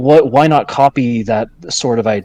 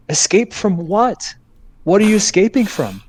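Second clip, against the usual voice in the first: incredulous; 9 semitones higher than usual. Real, not acted. Incredulous rhetorical questions.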